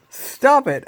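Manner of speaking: high, loud frantic plea; panicked plea. Acted. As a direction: beg the fish to stop. Rob panics at the fish.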